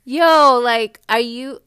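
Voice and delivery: explosive, exasperated "yo"; incredulous outburst. Real, not acted. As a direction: burst out in disbelief at the nerve. Incredulous reaction to his insulting message.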